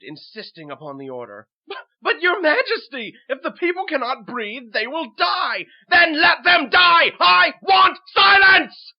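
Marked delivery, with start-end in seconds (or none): 1.7-5.7 high, loud stammering plea; frantic raised voice; desperate protest. Acted. Minister pleads with raging king. 5.9-8.9 very high, screamed, drawn-out fury; full-force enraged shriek; shrieking king. Acted. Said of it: "the shrieks of the king".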